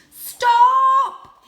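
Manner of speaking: very loud, high "Stop!"; shouted call. Acted. Cat calls out to the speeding cars, very loud and high. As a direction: yell it at the oncoming cars. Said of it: "he called".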